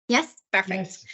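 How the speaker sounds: usual speaking voice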